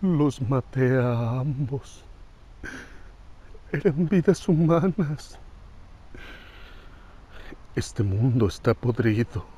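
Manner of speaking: hushed, halting monologue swelling to a proclamation; self-made god's dark confession; brooding anime antihero. Acted. Solemn anime monologue, character unnamed.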